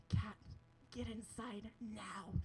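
low, quiet, slow command; deep-voiced stern order, each word measured; Mike. Rescuer behind her with a towel, deep quiet command. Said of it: "this deep voice".

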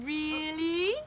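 long drawn-out oh really, swooping up; exaggerated intrigue. [name] plotting to tease Kat, drawn-out intrigue. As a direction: stretch both words with scheming interest. Teasing interest, drawn out further.

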